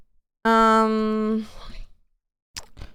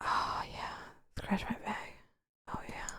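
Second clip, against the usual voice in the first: breathy whispered sighs of pleasure; whispering; 17 dB quieter than usual. Pretend. Enjoying the bag scratching.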